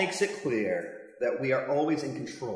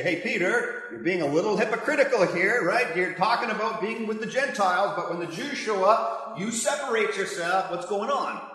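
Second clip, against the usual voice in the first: higher, louder voice calling out a friend; Paul confronting Peter; 6 semitones higher, 4 dB louder than usual. Retold or imitated. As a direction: confront him firmly, pitched up.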